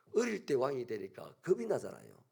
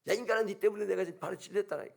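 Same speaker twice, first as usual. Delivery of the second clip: fast, higher and louder scolding; angry yell; 6 semitones higher, 5 dB louder, faster than usual. Acted. Adam yelling at Eve about his pricked foot, fast and angry. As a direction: bark at her over the wound.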